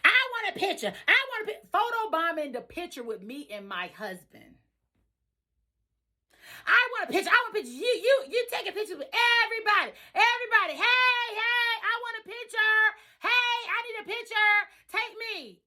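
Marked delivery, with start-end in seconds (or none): 0.0-1.7 high, whiny demanding voice; imitating pushy guest. Pushy guest at the wedding begging the bride for a picture, high whiny mimicry. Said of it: "here she come, peeping through the front door". 6.7-15.6 high, whiny demanding voice; imitating pushy guest. Pushy guest at the wedding begging the bride for a picture, high whiny mimicry. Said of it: "here she come".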